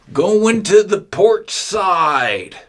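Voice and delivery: loud, slow, high call across the deck; captain calling orders. Acted. Captain shouts steering orders to his crew, loud and slow. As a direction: bellow it out like a ship's captain. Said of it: "he is able to say".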